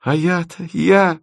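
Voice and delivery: high, indignant outcry; crying out. A narrator's reading. Bride breaks in on the groom's lament, crying out. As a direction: cry it out, pitched high. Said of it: "вскричала Тося".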